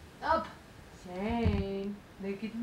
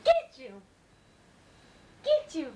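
startling boo; 7 dB louder, faster than usual. Acted. Mother startling baby in play.

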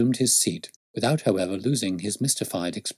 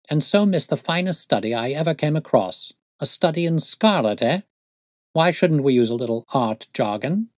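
lively, lightly voiced sleuth; exhilarated detective; 6 semitones higher, 4 dB louder than usual. Detective thanks friend and plans lunch, buoyant enthusiasm. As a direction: rise into eager, lyrical enthusiasm.

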